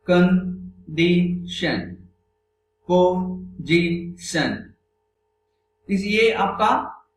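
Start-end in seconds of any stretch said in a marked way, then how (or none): 0.1-2.0 condition very slowly in three separate beats; syllable by syllable. Shown as a demonstration. Teacher showing three syllables, condition said syllable by syllable. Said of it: "तीन syllable है". 2.9-4.7 position slowly in three separate beats; syllable by syllable. Shown as a demonstration. Teacher counting three syllables aloud, position said syllable by syllable. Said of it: "तीन syllable का है ये".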